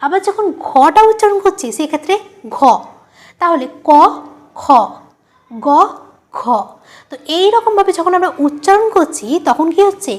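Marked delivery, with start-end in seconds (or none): 2.5-2.6 ঘ with a full puff of breath; aspirated ঘ. Shown as a demonstration. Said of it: "ঘ হচ্ছে মহাপ্রাণ". 3.9-6.4 ক, গ with little breath, খ, ঘ with a puff; contrasted aspiration. Shown as a demonstration. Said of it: "একটা অল্প প্রাণ হচ্ছে".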